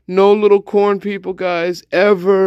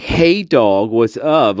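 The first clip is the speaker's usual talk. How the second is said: put-on valley girl; 8 semitones lower, slower than usual.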